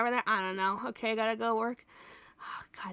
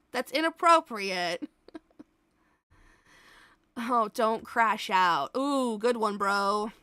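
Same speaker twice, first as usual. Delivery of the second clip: ordinary talk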